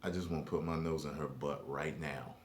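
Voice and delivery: low, fast, soft lusty mutter; quick hushed leer. Retold or imitated. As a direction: mumble it hungrily under breath. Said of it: "the dude was like".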